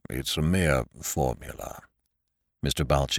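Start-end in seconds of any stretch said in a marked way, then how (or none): 0.1-1.8 low, quiet muffled voice. Acted. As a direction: voice with little left of it. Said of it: "in a muffled voice".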